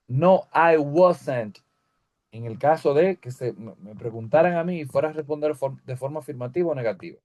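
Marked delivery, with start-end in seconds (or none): 0.1-1.6 slow model answer. Shown as a demonstration. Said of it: "teacher models short answer".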